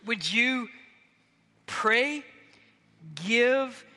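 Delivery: rally the congregation to go; preaching at full force. Real, not acted. A pastor urging the church to go on missions, forcefully.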